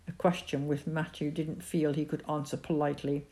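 usual speaking voice

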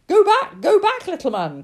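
high, loud warning cry. A narrator's reading. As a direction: cry out urgently to turn someone back. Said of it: "cried the philosopher".

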